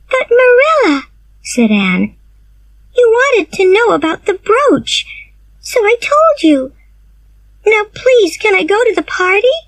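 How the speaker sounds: put-on very high, urgent begging girl; pleading girl. Anne pleads to go.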